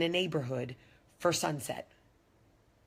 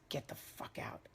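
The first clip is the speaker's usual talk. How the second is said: menacing whisper, much softer; whispered threat; 11 dB quieter than usual. Retold or imitated. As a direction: hiss it low and cold. Driver dismissing her at hotel.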